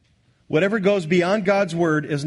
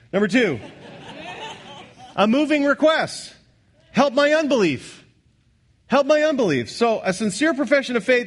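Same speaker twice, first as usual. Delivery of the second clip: full-force preaching; 7 semitones higher than usual. Acted. Preacher expounding the father's plea to the congregation, fervent and high. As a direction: preach it out, lifting the plea "Help my unbelief".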